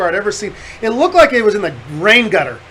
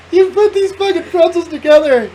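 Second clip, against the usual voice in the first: high-pitched, laughing through the words; can barely get it out for laughing; laughing hard; 7 semitones higher, 4 dB louder than usual. Real, not acted. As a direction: friend laughing while telling the pretzel story. Laughing at pretzel spill climax.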